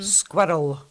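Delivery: SQUIRREL said "SKWER-ol"; O before the L. Shown as a demonstration. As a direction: give SQUIRREL a clear O before its L. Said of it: "there's an O in there".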